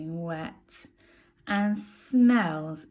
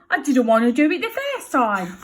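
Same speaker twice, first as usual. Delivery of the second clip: grumbling Bill; 6 semitones higher, 10 dB louder than usual. Acted. Bill rubbing his sore nose.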